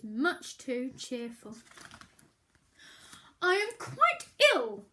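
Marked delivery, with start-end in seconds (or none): 3.4-4.9 sulky reminder. Acted. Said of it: "Bear reminded him".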